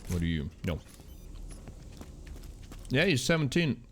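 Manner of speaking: usual speaking voice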